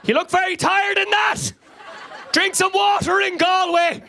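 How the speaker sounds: high-pitched shouted mum voice; mock shouting. Mother commenting on her son's photos, mock shouting. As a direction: call it out high, like typing in capitals. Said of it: "she's shouting at me".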